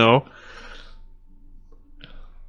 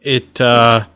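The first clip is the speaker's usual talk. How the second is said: ordinary delivery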